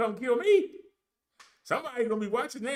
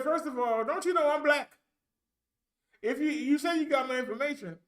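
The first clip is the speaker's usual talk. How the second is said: indignant retort; 7 semitones higher than usual. Retold or imitated.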